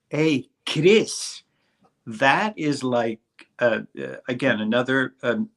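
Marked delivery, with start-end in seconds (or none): 0.1-1.4 put-on French Canadian accent. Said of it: "in French Canadian".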